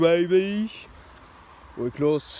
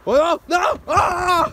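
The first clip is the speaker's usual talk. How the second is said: alarmed shout; 7 semitones higher, 5 dB louder than usual. Acted. Wrestler thrown into bins.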